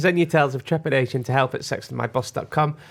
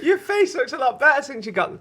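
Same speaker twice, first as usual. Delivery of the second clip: laughing; 13 semitones higher than usual. Real, not acted. Laughing while quoting William.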